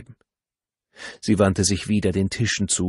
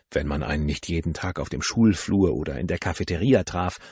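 plain reading voice

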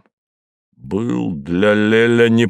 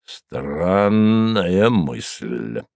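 slow, disapproving drawl; 2 semitones lower, much slower than usual. A narrator's reading. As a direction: dismiss it slowly. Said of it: "неодобрительно сказал Мозес".

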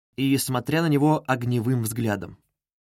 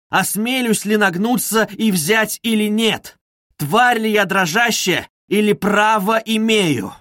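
builds from tense to near shout, higher and louder; each question pushed harder and higher; rising agitation; 5 semitones higher, 4 dB louder than usual. Acted. Раскольников всё громче и выше. (нарастающее возбуждение, всё выше и громче)

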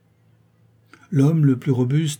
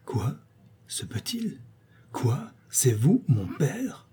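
astonished cry; 2 semitones higher, 5 dB quieter, slower than usual. A narrator's reading. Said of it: "je m'écrie".

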